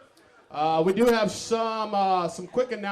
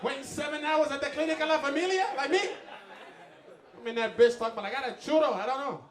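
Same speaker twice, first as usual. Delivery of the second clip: higher-pitched mock Mexican accent; 7 semitones higher than usual. Comedian mimicking someone waiting at the clinic, exaggerated Mexican accent. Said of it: "I did an offensive Mexican accent".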